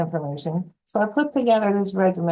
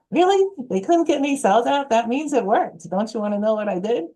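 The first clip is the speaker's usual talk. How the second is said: high, loud incredulous retort; excited disbelief; 6 semitones higher, 3 dB louder than usual. Retold or imitated. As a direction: squeal it as joyful news. Her excited retort retold.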